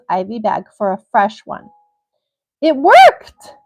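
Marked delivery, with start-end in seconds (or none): 2.6-3.5 high, loud burst of amazement; excited exclamation. Real, not acted. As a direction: exclaim it loudly, pitch leaping up. Excited reaction while reading the case.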